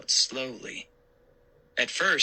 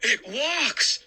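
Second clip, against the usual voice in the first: startled shout; 13 semitones higher than usual. Acted. Uncle shouts in fright at the mummy. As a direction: shout it in shock. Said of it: "he shouted".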